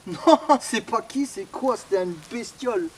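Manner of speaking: laughing off the fright; shaky relieved laughter; nervous laugh. Real, not acted. Nervous laugh after the scare.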